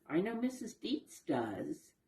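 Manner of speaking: ordinary delivery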